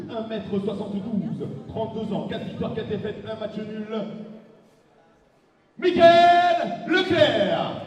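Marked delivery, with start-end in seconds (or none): 5.8-7.8 very loud, very slow and higher; booming ring announcer. Real, not acted. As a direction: hold every syllable for the arena. Ring announcer calls fighter name.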